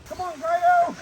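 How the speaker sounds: high, loud call across the yard; calling the pet. Real, not acted. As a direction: call him over from afar. Calling his pet over.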